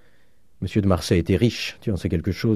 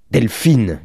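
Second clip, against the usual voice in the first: loud, raised cry of the name; 4 semitones higher, 6 dB louder than usual. A narrator's reading. Countess stepping towards her sister, crying out. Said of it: "cria la comtesse".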